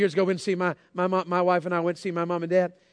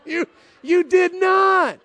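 high, slow, stretched-out you did not; shocked disbelief; 10 semitones higher, 5 dB louder, much slower than usual. Retold or imitated. Son reacts to dad halving the filet, high drawn-out disbelief. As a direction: drag it out in mock horror at dad's steak.

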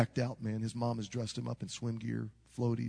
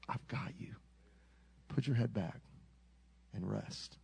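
tender reassurance; 2 semitones lower than usual. Retold or imitated. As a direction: settle him gently, fading to a whisper. Father comforting his panicked son.

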